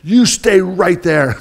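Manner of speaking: a homeowner yelling at a dog, mild mock yell; retold yell. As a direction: bark it out to stop the dog. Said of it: "so I yelled".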